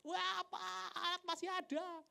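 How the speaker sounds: high-pitched cry of astonishment; excited yell. Retold or imitated. Caller reports at midnight that the equipment survived, yelling high. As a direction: cry out the news in a high, sustained voice.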